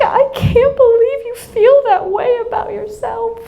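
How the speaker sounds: high, tearful wail; imitated crying child. Crying daughter upset at mother's self-talk, tearful wail. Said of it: "she's crying and we're both crying".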